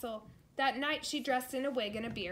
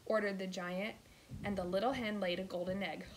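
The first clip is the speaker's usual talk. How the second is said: plain reading voice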